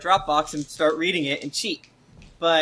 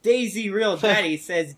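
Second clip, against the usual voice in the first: ordinary talk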